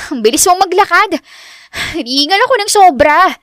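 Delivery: high, winded complaint; exasperated and puffing; out of breath. A narrator's reading. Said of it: "Hingal na hingal si Richelle".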